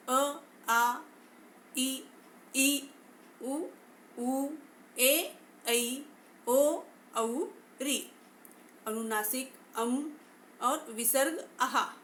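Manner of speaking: each vowel sounded out clearly; vowels recited. Shown as a demonstration. Teacher sounding out each Hindi vowel for students. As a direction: recite the vowels one by one for the class. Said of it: "स्वरों की संख्या तेरह है".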